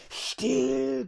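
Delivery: high, drawn-out hissed hush; strained, sibilant creature voice; Caliban hissing. A narrator's reading. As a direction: hiss sharply to silence someone. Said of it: "zischte Caliban".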